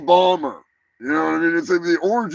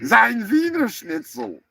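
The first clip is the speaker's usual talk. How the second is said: slow, higher, hammed-up German accent; bad German accent, slow and higher; mock German accent; 8 semitones higher than usual. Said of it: "I don't do a good German accent".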